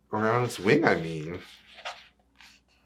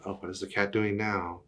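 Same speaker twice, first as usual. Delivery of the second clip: ordinary delivery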